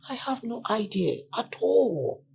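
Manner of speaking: put-on shocked to learn what the girl went through; distressed disbelief. Woman shocked by girl's ordeal.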